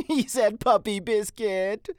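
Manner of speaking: high, loud, slow mocking delight; amused passer-by. A narrator's reading. Woman telling her companion about Mitty, laughingly amused. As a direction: crow it, tickled by the oddity. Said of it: "A woman who was passing laughed".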